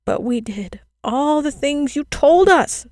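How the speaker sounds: put-on a desperate child's plea, voice stretched thin; children pleading with the girl in despair; crying despairingly. A narrator's reading. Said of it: "the children cried despairingly".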